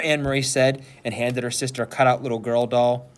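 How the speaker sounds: ordinary delivery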